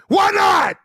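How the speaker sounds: high, loud chewing-out; mock officer yelling. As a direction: bellow at the sailor like a furious lieutenant. Lieutenant shouting at sailor.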